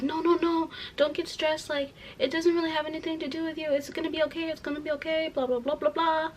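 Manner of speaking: high, put-on soothing voice; mock a fussing manager, softly and high; imitated reassurance. Manager calming a stressed employee, mock reassurance.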